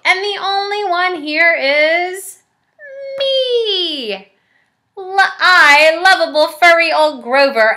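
high, loud delighted monster voice; relieved Grover. Acted. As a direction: Grover realizing he's the monster.

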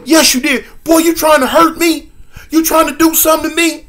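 high, loud, voice cracking with hurt; mock tearful rage; tearful accusing stepdad. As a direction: accuse the boy, on the edge of crying. Stepdad accusing, near tears.

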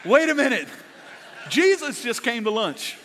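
high, loud mock amazement; loud, high group reaction, playfully amazed; astonished. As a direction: exclaim in hammed-up astonishment. Group's acted astonished reaction at lunch.

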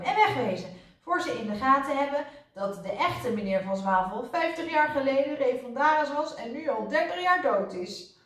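hurried getaway order; a touch of excited urgency; urgent calling. A narrator's reading. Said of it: "riep meneer Pijpentoon". (gehaast roepend)

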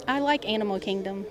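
ordinary talk

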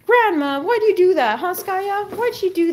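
high-pitched playful complaint; mock scolding. Mock complaint to grandma for dog.

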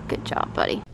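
whispered praise. Real, not acted. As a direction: breathe it softly to the pet.

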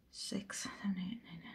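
counting under her breath; hushed count to herself; whispered counting. Real, not acted. Counting savings to herself, whispered.

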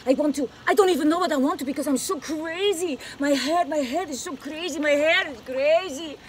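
put-on over-the-top Spanish accent telenovela diva; big crazy telenovela star with Spanish accent. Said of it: "Can you do a Spanish accent?".

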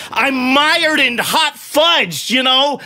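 ordinary talk

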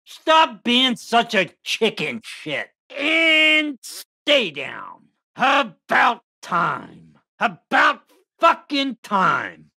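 cocky, taunting doll villain voice; gloating killer doll. Acted. Killer doll catching a runaway victim, gloating menace.

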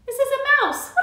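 high, loud squeaky mouse voice; teacher voicing a mouse puppet. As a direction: speak as a tiny excited mouse.

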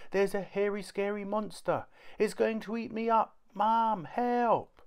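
very high child voice, faked panic; a small boy pretending a monster will eat him; little boy's fake alarm. A narrator's reading. Kipper fakes monster fright to Mum, very high.

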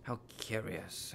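soft, slow wondering; hushed puzzlement. Acted. Wandmaker puzzles over the chosen wand, quietly intrigued. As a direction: drop to a slow, intrigued murmur.

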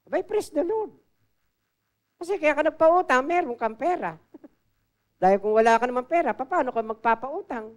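high, loud preacher's exhortation with a laugh; fired-up sermon delivery; preaching at full force. Acted. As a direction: rouse the congregation, voice climbing.

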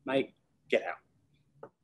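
slow, drawn-out, disbelieving; scornful dismissal. Retold or imitated. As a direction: drag it out in disbelief. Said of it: "client dismisses consultant".

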